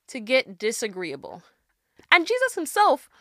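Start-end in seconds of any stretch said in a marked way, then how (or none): 2.1-3.1 much higher and louder, fired up; rising excitement about a bold idea; excited emphasis. Real, not acted. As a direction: sell the point with excitement.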